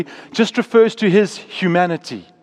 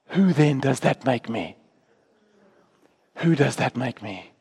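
soft and low after a pause; quiet, searching question; 6 semitones lower, 6 dB quieter than usual. Acted. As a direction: let the question land softly, lower. Acted question of Jesus, hushed.

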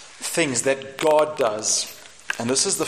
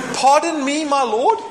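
high, louder, laughing squeak; squeaky Gideon; 10 semitones higher, 6 dB louder than usual. Acted. Gideon doubting the angel, squeaky and laughing. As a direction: squeak it out like a scared little man.

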